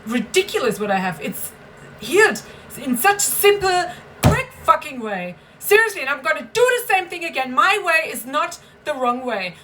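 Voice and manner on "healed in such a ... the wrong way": 1 heated outburst. Real, not acted. Angry rant about her healing.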